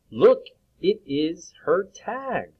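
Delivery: slow, lifted story voice; teacher reading the story aloud to children. Acted. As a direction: read it slowly and brightly. Reads story line aloud.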